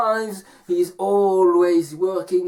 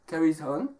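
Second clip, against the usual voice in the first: usual speaking voice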